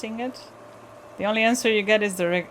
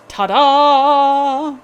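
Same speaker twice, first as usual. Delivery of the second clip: loud, higher, drawn-out ta-da; triumphant; 6 semitones higher, 12 dB louder than usual. Acted. Player reading a game's stage direction, triumphant. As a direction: announce it with a big showy flourish.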